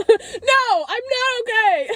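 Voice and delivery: high, laughing-crying wail; half-joking outburst through tears; mock wail. Crier answering "are you okay", mock wailing. Imagined answer to worried passer-by.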